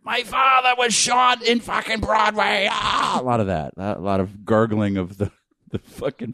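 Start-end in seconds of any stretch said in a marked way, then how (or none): 0.0-3.2 high, slow, straining actor's wail; overacted Boston accent. LA actor in a terrible play, overwrought Boston accent. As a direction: ham up bad Boston melodrama. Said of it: "actor types trying to do a Boston accent".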